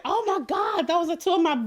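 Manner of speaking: retold squeal of surprise, pitched well up; delighted disbelief, lifted high; excited recognition. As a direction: replay the thrilled "no way" moment.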